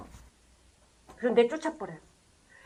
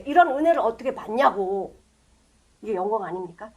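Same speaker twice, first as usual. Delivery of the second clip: normal talking voice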